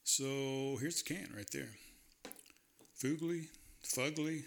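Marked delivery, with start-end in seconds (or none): 3.0-4.4 Fugly as FOOG-lee, then as FUG-lee; two ways of saying it. Shown as a demonstration. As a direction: say Fugly first with oo, then with short uh. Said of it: "however you wanna say it".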